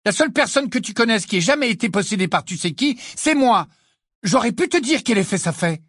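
high-pitched indignant outburst; heated rebuke. A narrator's reading. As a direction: keep the temper up, pitched high throughout. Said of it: "s'emporta Ginny". (reproche emporté, voix aiguë)

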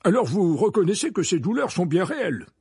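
high, loud, hurried question; hasty reply. A narrator's reading. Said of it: "répondit précipitamment Fudge".

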